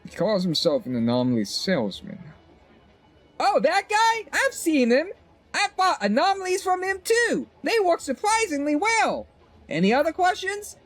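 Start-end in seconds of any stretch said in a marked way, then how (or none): 3.4-10.8 put-on much higher perky shop girl voice; chirpy shopkeeper. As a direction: voice the cheerful vendor up high.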